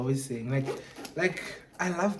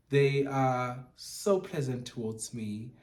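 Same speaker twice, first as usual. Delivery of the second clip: usual speaking voice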